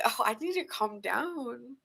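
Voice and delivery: flustered, swooning. Acted. Reader voices Gemini with heart racing, mock flustered. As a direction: act out melting, trying to settle. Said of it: "this person gets your heart racing".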